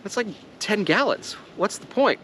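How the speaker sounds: mock American viewer scoffing at ten gallons; imitating American viewers. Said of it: "And you're like".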